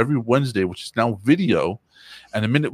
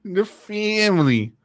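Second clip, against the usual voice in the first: silly mock voice; 11 semitones higher, slower than usual. Echoing in silly voice.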